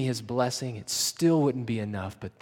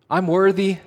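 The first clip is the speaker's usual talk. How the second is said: higher and louder, ringing claim; loud declaration; 9 semitones higher, 12 dB louder than usual. Acted. Jesus on the cross declaring to the Father, loud declaration. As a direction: proclaim it outright.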